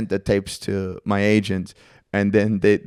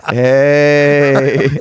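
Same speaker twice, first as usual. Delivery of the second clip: a loud, goofy hey; mock dumb hey; 2 semitones higher, 5 dB louder than usual. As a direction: blurt a silly hey to tease.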